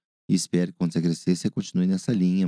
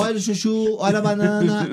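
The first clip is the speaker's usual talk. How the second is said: market vendor call; 12 semitones higher than usual. Pretend. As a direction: shout out produce like a market seller. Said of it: "feira, feira, vender".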